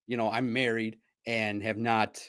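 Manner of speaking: usual speaking voice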